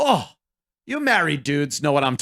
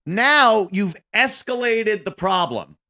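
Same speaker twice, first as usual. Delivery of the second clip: normal talking voice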